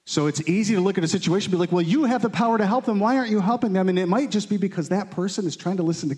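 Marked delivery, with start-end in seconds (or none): 1.7-3.9 high, incredulous challenge; mock accusing. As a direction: demand it like an impatient skeptic. Skeptic demanding help from Jesus.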